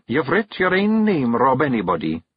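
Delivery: high Scots wife, proud; proud Kelda. Acted. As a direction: tell him he did it. Jeanie's voice.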